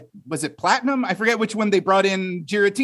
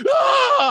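startled scream; 3 dB louder than usual. Retold or imitated. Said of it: "enough to make you go, like".

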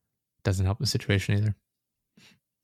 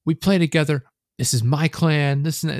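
high-pitched whiny-angry mimicry of the teammate; mock an indignant clan leader insisting; retold tantrum, pitched well up; imitated angry protest; 6 semitones higher, 4 dB louder than usual. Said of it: "He's like".

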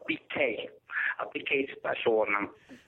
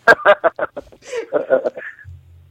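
loud laugh; 5 semitones higher, 12 dB louder than usual. Acted. Caller bursts out laughing.

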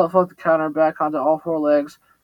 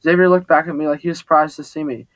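usual speaking voice

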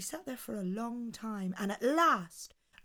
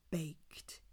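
whispered; 4 semitones lower, 10 dB quieter than usual. A narrator's reading. Storyteller builds suspense.

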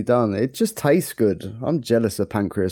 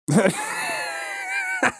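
1 shrieking laugh; 5 dB quieter than usual. Real, not acted. Host laughs at own joke.

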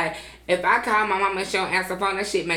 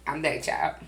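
ordinary talk

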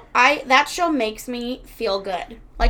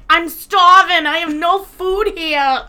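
louder, slow mock whine; a hint of a petulant son, slow and loud; whiny demanding son; 5 semitones higher, 7 dB louder, slower than usual. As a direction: ham up a spoiled complaint. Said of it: "And he's like".

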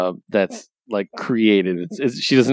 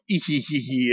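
soft cartoon villain giggle; trailing evil snicker; mock evil laugh; villain cackle; 2 semitones higher, 5 dB quieter than usual. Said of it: "Rapscullion's entire speech".

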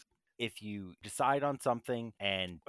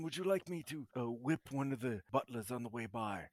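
stiff servile butler. Acted. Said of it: "my fancy butler voice".

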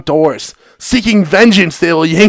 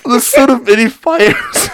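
holding back laughter; 7 semitones higher, 4 dB louder than usual. Real, not acted. Reading while fighting laughter.

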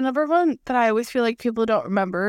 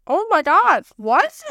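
exasperated surprise; 6 semitones higher, 5 dB louder, slower than usual. Retold or imitated. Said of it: "And I'm like".

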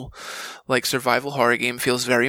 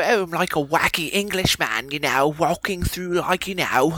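wacky Englishman; 4 semitones higher than usual. Retold or imitated. Mocking the old voice actor's goofy English accent to the hosts. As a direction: send up the old actor as a silly English toff. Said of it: "He sounded like Russell Brand".

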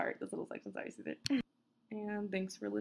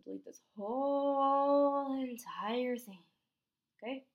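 exasperated plea; 5 semitones higher, 6 dB louder, slower than usual. Real, not acted. Flustered plea to the editor.

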